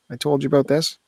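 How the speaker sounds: ordinary talk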